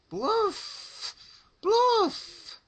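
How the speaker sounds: very high, loud tongue-tied cries; frightened mumbling. Acted. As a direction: cry out in terror without a working tongue. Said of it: "mumbling and moaning, frightened".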